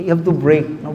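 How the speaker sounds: normal talking voice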